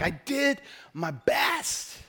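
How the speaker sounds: drawn-out mock whine, rising at the end; frustrated protest. Preacher voicing people who keep failing, frustrated. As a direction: stretch each word in helpless frustration. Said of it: "it's just so frustrating".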